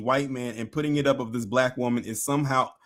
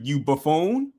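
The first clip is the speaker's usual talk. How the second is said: ordinary talk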